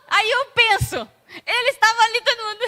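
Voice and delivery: fast, high and loud, nearly laughing; excited laughing rush. Acted. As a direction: rush it out in delight. Excited acted retelling. (empolgado, rindo, rápido)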